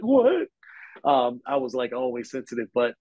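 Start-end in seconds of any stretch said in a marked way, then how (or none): 0.0-0.5 high, louder mock-wounded yelp; oversensitive kid. Reporter recalls being a sensitive kid, mock-hurt high outburst. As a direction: act out a touchy child taking offense. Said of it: "I've always been a sensitive person".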